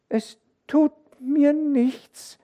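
hohe, stockend langsame Kinderstimme; ängstliches Kind, das sich Mut zuspricht; zitternd. A narrator's reading. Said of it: "sagte sie zitternd". (zitternde Kinderstimme)

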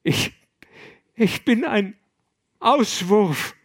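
high, loud anguished sobbing cry. Acted. Young monk sobbing on the abbot's shoulder, high loud wail. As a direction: wail out the self-loathing, near breaking. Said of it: "schluchzte Gregors".

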